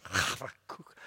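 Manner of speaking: "Habakkuk" with a breathy start and final KOOK; Hebrew-style pronunciation. Shown as a demonstration. Preacher exaggerating the Hebrew sound, end-stressed. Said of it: "us still in English saying".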